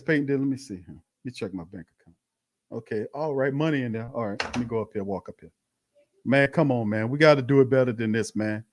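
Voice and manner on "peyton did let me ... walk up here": much softer, lower, an offhand murmur to himself; mock nonchalant coach. Host mocking Coach Peyton checking his bank account, muttered and carefree. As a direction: act out the coach strolling up after checking his pay. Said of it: "Peyton did this".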